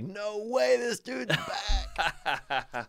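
high, amused disbelief; mocking crowd voice. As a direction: squeal it like a stunned heckler. Retold crowd groaning at him.

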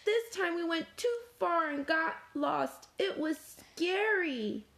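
high, upset little voice; Spoon's storybook sobbing, lightly acted; sobbed account. A narrator's reading. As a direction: tell it tearfully in a high voice. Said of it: "Spoon sobbed".

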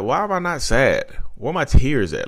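high, insistent plea; retold exasperated question to a therapist; baffled self-questioning. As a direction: ask it high and bewildered. Acting out his puzzled plea.

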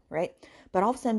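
usual speaking voice

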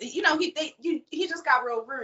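ordinary talk, nothing marked

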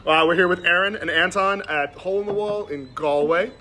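ordinary delivery

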